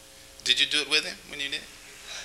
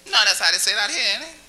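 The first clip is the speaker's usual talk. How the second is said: ordinary delivery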